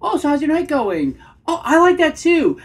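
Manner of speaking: high, loud questioning lilt; over-eager, end each line way up high; voice rising an octave up. Shown as a demonstration. Coach mimics nervous guys on a date, octave-up questioning. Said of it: "their voice ends an octave up".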